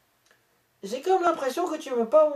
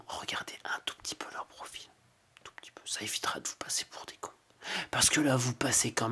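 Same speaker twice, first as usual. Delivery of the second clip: hushed unvoiced whisper to the viewers; conspiratorial whisper; 10 semitones lower, 10 dB quieter than usual. Real, not acted. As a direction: lean in and whisper the jab. Whispered asides to the audience.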